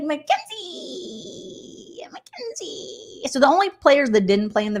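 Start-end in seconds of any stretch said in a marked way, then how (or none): 0.0-3.3 much higher, very slow, fading to a whisper; doting Angela. Retold or imitated. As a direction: croon the name lovingly, then breathe it. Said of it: "She's like".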